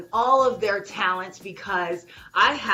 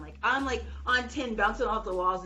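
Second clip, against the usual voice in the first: ordinary delivery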